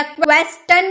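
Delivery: क्वेश्चन with a hard "t", as kwes-tun; क्वेश्चन ending in "tun", as the mistake; wrong T pronunciation. Shown as a demonstration. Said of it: "या क्वेश्चन नहीं".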